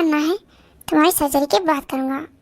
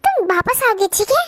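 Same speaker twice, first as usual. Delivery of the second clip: ordinary talk, nothing marked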